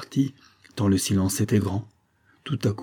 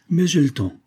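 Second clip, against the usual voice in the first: higher-pitched, light and amused; playful lift, no real laugh; 7 semitones higher, 4 dB louder than usual. A narrator's reading. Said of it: "ajouta-t-elle en riant".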